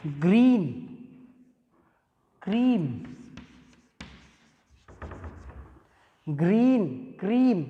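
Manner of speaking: "ग्रीन", "क्रीम" with a long ee; "ग्रीन" and "क्रीम" with the ee held long; model words. Shown as a demonstration. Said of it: "example आपका है".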